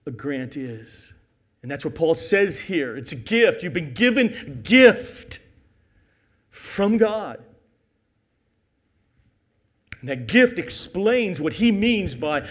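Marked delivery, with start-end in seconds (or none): 1.6-12.4 loud, raised voice hammering the key words; full-force preaching. Real, not acted. Preacher explaining God's gift to the congregation, full-force preaching. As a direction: preach it out emphatically.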